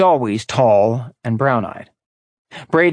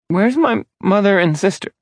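put-on frightened girl; 8 semitones higher than usual. Dazed girl asks for family.

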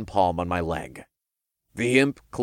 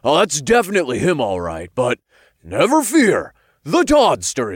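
loud, higher-pitched swaggering imp; cocky imp bravado; 6 semitones higher, 6 dB louder than usual. A narrator's reading. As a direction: announce yourself like a cocky hero. Imp's bravado voiced in story.

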